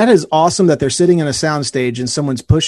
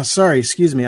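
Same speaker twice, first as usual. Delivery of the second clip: ordinary talk, nothing marked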